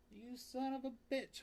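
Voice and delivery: high squeaky teasing cry; high mock-insult to a pet; playful pet squeal. Real, not acted. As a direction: squeal it at the cat in mock outrage.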